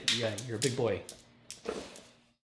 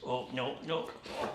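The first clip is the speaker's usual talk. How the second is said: ordinary delivery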